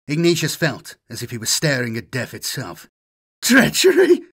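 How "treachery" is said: high, loud shriek of betrayal; screaming. Acted. Governor screams betrayal at the assassin, high and loud. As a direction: scream it out in shocked outrage. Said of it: "he screamed".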